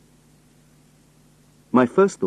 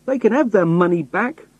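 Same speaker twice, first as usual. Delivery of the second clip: high-pitched, aggrieved outburst; crying out; 11 semitones higher, 3 dB louder than usual. A narrator's reading. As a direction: cry out in protest, pitch way up. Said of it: "I cried".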